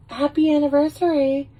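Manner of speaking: high, chirpy acted greeting; high-pitched mock sweetness; perky ex-girlfriend. Voices the ex-girlfriend with a gift.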